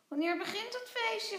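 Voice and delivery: high, bright-eyed tiny creature voice; playful little creature calling; little creature Vlos. Acted. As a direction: squeak it out like a small cheeky critter. Vlos asks eagerly.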